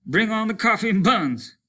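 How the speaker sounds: high, loud shout; cheerful yell through the door. Acted. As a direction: call into the dome. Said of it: "he yelled".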